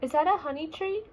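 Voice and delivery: usual speaking voice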